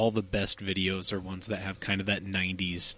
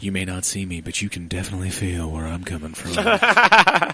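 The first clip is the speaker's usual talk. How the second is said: sultry perfume ad; 8 dB louder than usual. Pretend. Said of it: "my sensual, sultry voice".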